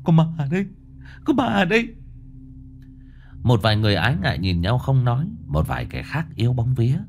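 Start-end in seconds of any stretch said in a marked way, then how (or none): 0.0-1.9 rising terror. A narrator's reading. Huy telling the crowd there's a ghost, in rising terror. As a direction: panic building higher to a shriek. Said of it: "giọng nói bất an như tắc nghẹn".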